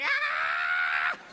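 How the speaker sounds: long mock scream of boredom; frustrated scream. Bored listener cutting off a dull story, screaming. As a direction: scream as if you can't bear the story. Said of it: "I just wanna start screaming".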